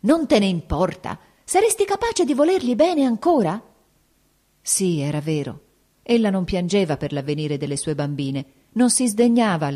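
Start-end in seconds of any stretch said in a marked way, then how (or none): 0.0-3.6 disbelieving shout, climbing much higher; father shouting, appalled she still loves him. A narrator's reading. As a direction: yell it at her in outrage. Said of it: "egli gridò".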